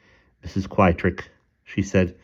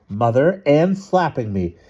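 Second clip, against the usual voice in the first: put-on tattling child; 7 semitones higher, 4 dB louder than usual. A narrator's reading. Child tattles on sister to mother.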